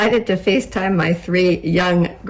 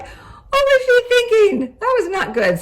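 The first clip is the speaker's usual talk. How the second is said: incredulous, pitched way up; exasperated disbelief. Retold or imitated. Mom recalling a depressing movie her daughter recommended, exasperated disbelief.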